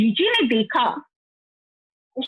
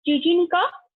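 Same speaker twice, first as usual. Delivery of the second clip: plain reading voice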